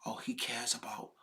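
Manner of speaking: very soft, whispery aside; barely voiced, dismissive imitation; hushed mock quote. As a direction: drop almost to a whisper. Mimics a supposed reason, hushed.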